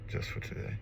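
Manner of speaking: soft whispered echo of the slogan. Shown as a demonstration. Sober man whispering his daily slogan. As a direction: breathe it back to yourself. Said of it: "But just for today".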